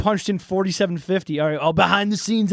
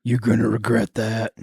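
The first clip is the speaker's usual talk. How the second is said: much lower, cold warning; menacing threat; 11 semitones lower than usual. Acted. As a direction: threaten him low. Drifter threatens John.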